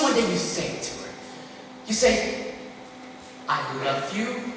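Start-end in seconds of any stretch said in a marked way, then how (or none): 3.5-4.5 "I love you" with a rising, unsure end like a question; "I love you" tentatively lifted at the end. Retold or imitated. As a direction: say "I love you" as if asking, not stating. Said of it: "phrase it as a question".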